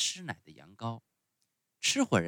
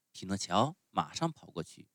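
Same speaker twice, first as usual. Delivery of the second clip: usual speaking voice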